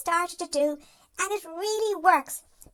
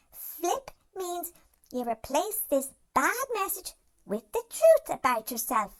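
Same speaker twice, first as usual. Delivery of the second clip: ordinary talk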